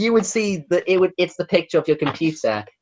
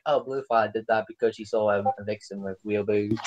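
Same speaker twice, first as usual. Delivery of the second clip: much softer, lower guilty mumble; sheepish excuse; 6 semitones lower, 10 dB quieter than usual. Retold or imitated. Friends sheepishly explaining the broken computer to Joe, soft guilty mumble. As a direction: confess it like caught kids. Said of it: "we're just like".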